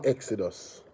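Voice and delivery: usual speaking voice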